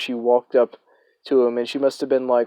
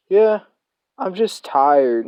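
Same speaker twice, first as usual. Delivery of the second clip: spaced-out reply; 6 semitones higher, slower than usual. Retold or imitated. Stoned student answering the chaperone, spaced out and slow. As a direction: drift off like a tripping teen. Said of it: "And he was like".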